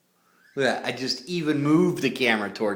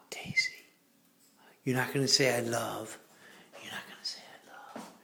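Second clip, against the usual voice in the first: hushed whisper to the bird; 3 semitones lower, 9 dB quieter than usual. Real, not acted. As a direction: whisper coaxing her. Gasp then whispering to bird.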